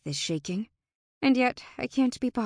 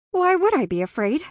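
higher and louder, defiant; 9 semitones higher, 7 dB louder than usual. A narrator's reading. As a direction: throw the question back hard. Defiant retort to fae.

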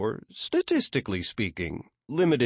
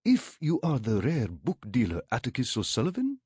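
put-on higher, lilting Arabic-accented inquiry; Nkosi El Kasab's Arabic accent; 4 semitones higher than usual. A narrator's reading. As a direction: ask courteously in an Arabic accent. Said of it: "the lilt of an Arabic accent".

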